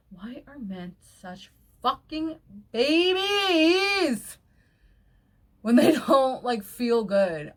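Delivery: slow build to a loud, high wail; exasperated rant. Real, not acted. Ranting about men with headaches, exasperated and building loud. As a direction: stretch the complaint out in exasperation.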